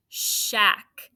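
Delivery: ordinary talk, nothing marked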